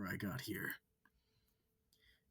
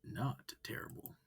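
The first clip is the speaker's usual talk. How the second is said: usual speaking voice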